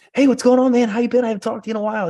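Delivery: friend's big hello. Retold or imitated. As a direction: imitate the buddy who calls anyone happily. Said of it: "my best friend can call anybody and be like".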